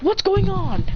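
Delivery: high, startled question; alarmed onlookers. Acted. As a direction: ask as if jolted awake by a fight. Acted alarmed crowd in story.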